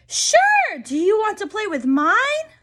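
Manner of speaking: very high squealing yes; excited child. Acted. The other friend replying, very high excited squeal. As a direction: burst with joy. Other girl answers eagerly.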